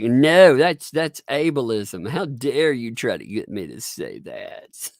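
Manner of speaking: loud, high protest, words stretched out; mock indignation. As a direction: act scandalized for comic effect. Acts out mock outrage at exercise.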